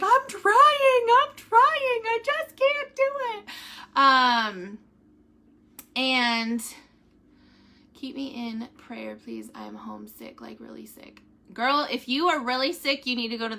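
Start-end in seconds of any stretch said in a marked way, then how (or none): 0.0-3.4 high, slow crying plea; retold upset plea. To her husband she can't lip-read, a high, slow, crying plea. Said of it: "I was getting all upset because I'm like". 7.9-11.2 quiet reading. Acted. Reading a viewer's prayer request.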